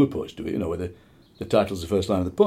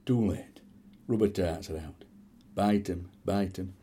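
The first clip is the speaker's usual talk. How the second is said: hushed poem ending; 2 semitones lower, 7 dB quieter than usual. Acted.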